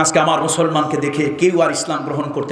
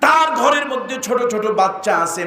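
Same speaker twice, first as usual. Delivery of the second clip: high, ringing preacher's delivery; impassioned preaching; 10 semitones higher than usual. Acted. Preacher telling a crowd of a penniless shopkeeper, impassioned. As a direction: preach it in a high, sustained chant.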